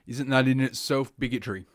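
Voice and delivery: usual speaking voice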